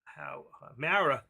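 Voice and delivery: usual speaking voice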